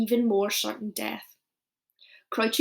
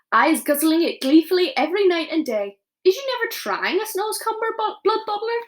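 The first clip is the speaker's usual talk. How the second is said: higher, lively giant voice selling his food; a cheerful giant bragging about his supper; friendly giant, eager; 7 semitones higher than usual. Acted. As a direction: coax him like a proud cook. BFG's voice offering the vegetable.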